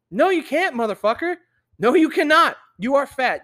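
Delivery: loud, high, emphatic contradiction; indignant rebuttal. Real, not acted. Mock argument with people claiming health.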